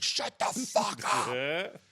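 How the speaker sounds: high, drawn-out mock yell; mock robber yell. Robber telling the kids off, mock yell. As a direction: stretch it out in a strained, hammed-up robber voice. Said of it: "the one robber is, like".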